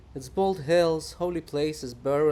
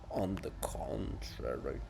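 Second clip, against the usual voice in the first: softer, slower, lower under the breath; quiet smug mumble; low slow mutter; 8 semitones lower, 8 dB quieter, slower than usual. Acted. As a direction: repeat it to yourself. Muttered repeat of retort.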